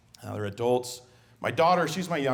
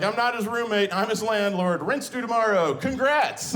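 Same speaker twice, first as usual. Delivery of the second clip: higher, louder sarcastic landlord jab; sarcastic retort; 10 semitones higher, 5 dB louder than usual. Retold or imitated. Dad answering son through the door, smug sarcasm. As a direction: deliver the comeback with smug sarcasm.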